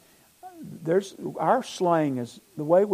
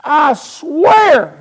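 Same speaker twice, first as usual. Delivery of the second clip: loud, high, forceful vow; sworn oath; 11 semitones higher, 13 dB louder than usual. Acted. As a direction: belt out the oath, leaning on swear. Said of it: "That's with an oath".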